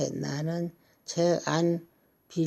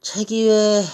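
louder, higher, spelled out clearly; to someone hard of hearing; 5 semitones higher, 9 dB louder than usual. Real, not acted. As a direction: speak up so grandpa can hear.

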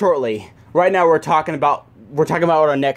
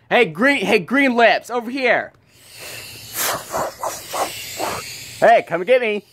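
teasing high taunt; 6 semitones higher than usual. Acted. Deadpool taunting the villain.

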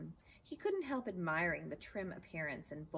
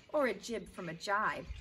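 louder eager cry; 4 semitones higher, 6 dB louder than usual. Acted. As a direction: shout it out to join the insult game. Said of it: "cried Stuart".

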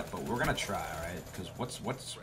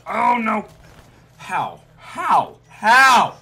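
high, very loud cries of dismay; shouted disbelief; 13 semitones higher, 17 dB louder, much slower than usual. Real, not acted. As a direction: shout it in disbelief, each one bigger. Player reacts to being killed.

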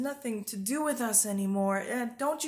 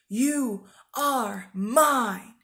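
louder, very slow, weighty declaration; solemn voice of God; 5 dB louder, much slower than usual. Retold or imitated. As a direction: proclaim it slowly like God claiming her. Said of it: "and he goes".

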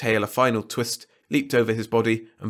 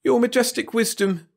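high, pleading whine; whining; 4 dB louder than usual. A narrator's reading. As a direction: whine it up to the ruler. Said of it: "whined Wirt".

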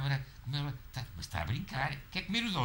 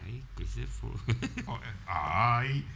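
normal talking voice

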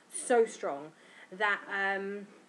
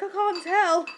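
higher, louder stunned "can't tell"; a dazed husband; mock Darren; 10 semitones higher, 11 dB louder, slower than usual. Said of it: "he's like".

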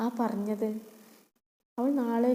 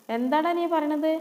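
loud, higher stepmother call from another room; angry shout; 6 semitones higher, 6 dB louder than usual. Acted. Stepmother shouts at her son from inside, angry. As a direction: bark back at the boy.

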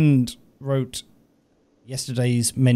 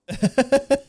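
laughing; 12 semitones higher than usual. Real, not acted. Player laughs at the scene.

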